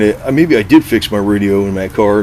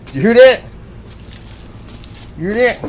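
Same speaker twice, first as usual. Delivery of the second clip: much higher, bright eager questions; excited to the cat. Real, not acted. Man asking his cat if it heard the car horn, excited and high. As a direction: ask the cat with glee.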